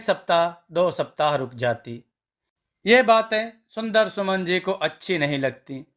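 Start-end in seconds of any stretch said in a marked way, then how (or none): none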